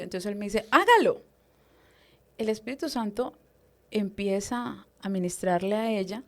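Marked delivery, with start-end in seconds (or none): 0.7-1.2 high, sudden retold order; imitated command. Leader telling her to minister, imitated command. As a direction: jump up in pitch for a quick order.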